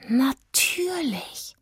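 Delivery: langsame, flüsternde Erkenntnis; geflüstert. Acted. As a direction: hauch es erstaunt. (flüsternd begreifend)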